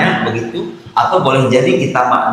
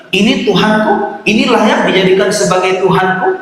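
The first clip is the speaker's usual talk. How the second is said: higher, challenging rhetorical question; sceptical, pitched-up taunt; mocking challenge; 7 semitones higher than usual. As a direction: say it to expose the falsehood. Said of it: "dengan nada ingin membuktikan kebatilannya".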